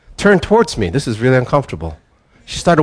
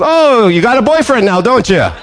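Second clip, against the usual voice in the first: put-on high, teasing old-man voice, noticeably louder; a jolly grandpa ribbing his wife; 11 semitones higher, 4 dB louder than usual. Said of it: "he's just a jolly old guy".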